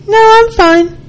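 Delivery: a clipped fake I'm fine; sulky denial. Acted sulky denial in dialogue.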